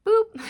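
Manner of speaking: playful boop. Retold or imitated. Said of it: "popping messages into your brain like".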